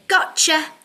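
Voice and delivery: a bright, punchy gotcha; playful gotcha. Shown as a demonstration. Teacher showing the class the game word to shout. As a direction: snap it out like catching someone. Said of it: "you have to say".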